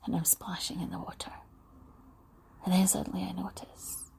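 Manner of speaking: soft whispered imagery, unhurried; guided visualisation whisper. Acted. Guide listeners to an imagined beach, whispering.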